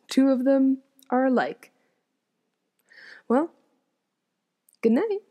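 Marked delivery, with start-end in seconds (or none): none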